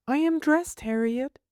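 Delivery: put-on high, prim little girl. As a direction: voice the small girl, high and earnest. Child voice in read novel.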